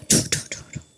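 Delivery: breathless unvoiced tutting; fed-up wife whispering under her breath; exasperated whispered duh. Wife sighing in complaint.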